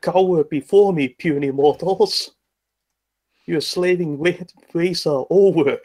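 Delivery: booming villain proclamation; loud, commanding godlike threat. Acted. Intimidating ogres from tower wall.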